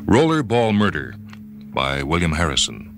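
ordinary delivery

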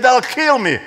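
high, alarmed cry of fear; frightened protest. Retold or imitated. Soldier panics at being sent back to the corporal, frightened cry. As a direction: blurt it out as if your life is on the line.

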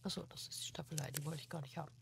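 hushed whisper. Real, not acted. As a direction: whisper it so the ghost won't hear. Hiding from ghost, whispering.

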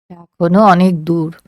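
usual speaking voice

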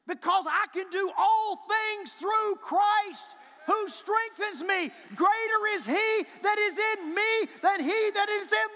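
loud climactic sermon cry; preaching at full force. Acted. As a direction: proclaim it to the rafters. Shouted declaration of faith.

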